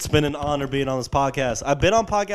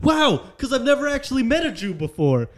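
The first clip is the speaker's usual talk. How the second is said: high, wide-eyed amazed voice; amazed girl voice; 10 semitones higher than usual. Retold or imitated. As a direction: gush like she's amazed. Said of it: "she went".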